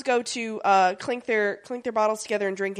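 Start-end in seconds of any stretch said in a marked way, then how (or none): none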